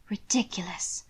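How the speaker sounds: a soft, whispered dismissal; dismissive. Acted. Margot at breakfast, dismissing Hannibal's remark in a whisper. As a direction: brush the word away in a whisper. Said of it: "Margot's dismissive pronouncement".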